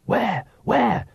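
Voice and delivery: high, sharply hissed question; urgent hiss. A narrator's reading. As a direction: hiss it in mock alarm, pitched well up. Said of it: "hissed Magrat".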